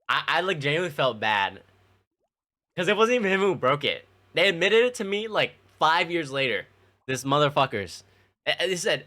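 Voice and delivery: ordinary talk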